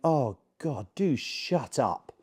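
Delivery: slow, drawn-out weary plea; mock long-suffering exasperation; imitating exasperated dog. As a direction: drag it out, fed up with the owner. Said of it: "they're over there going".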